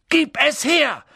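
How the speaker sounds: high, loud angry demand; shouting. A narrator's reading. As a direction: yell the order at full force. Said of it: "schrie Harry".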